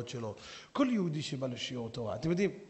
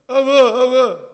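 very loud, higher desperate call; crying out; 8 semitones higher, 19 dB louder, much slower than usual. Acted. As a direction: yell for your father. The son cries out to father.

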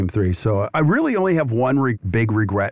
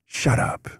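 a soft whispered "shut up"; whispered retort; 4 dB quieter than usual. Retold or imitated. Intense coach cutting him off, in a whisper. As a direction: hush it out under the breath. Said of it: "this is what he said to me".

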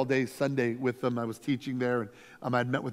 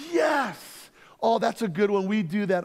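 eager agreement; 6 semitones higher, 4 dB louder, slower than usual. Retold or imitated. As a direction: burst out with a much higher "Yes!", then keep it bright and louder.

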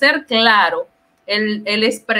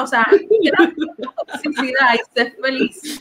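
laughing mock advice; slower than usual.

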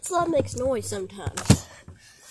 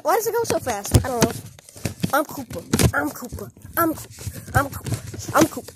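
ordinary delivery

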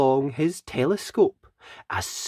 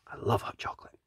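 whisper; 5 semitones lower, 10 dB quieter, much faster than usual. Acted. Savouring hot chocolate aside.

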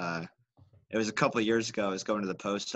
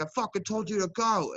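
angry trucker; 10 semitones higher than usual. Retold or imitated.

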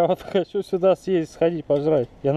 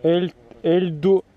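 slow halting reading; much slower than usual. Real, not acted. Sounding out a sign name.

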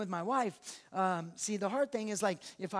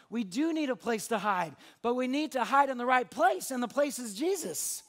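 loud, driving sermon; loud impassioned preaching; full-force preaching; 2 semitones higher, 6 dB louder than usual. Acted. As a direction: build and proclaim it boldly.

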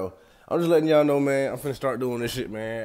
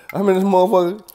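higher, louder burst at the heat; heat outburst; 9 semitones higher, 11 dB louder than usual. Real, not acted. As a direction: blurt it out, fed up with the heat. Complaining loudly about the heat.

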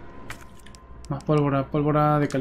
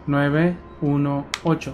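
slow deliberate reading; 4 dB quieter, much slower than usual. Real, not acted. Repeating code slowly.

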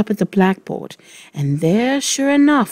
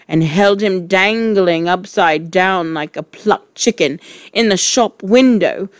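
plain reading voice

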